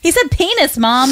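high child's voice telling Mom "He said penis", mock yell; imitating her screaming kid. Mother retelling her son announcing it to her in the theater, high mock child yell. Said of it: "And he's just screaming".

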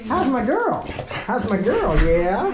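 high, cooing pet voice. Real, not acted. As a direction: coo lovingly at the dog. Greeting the dog.

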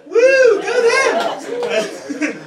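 high, loud sarcastic whoop; mock cheer. Comic cheering the corporation that fired him. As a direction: cheer them on with fake glee.

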